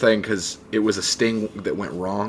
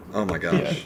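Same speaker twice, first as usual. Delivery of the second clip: excited laughing gasp; 9 semitones higher, slower than usual. Real, not acted. Thrilled at Metallica in trailer.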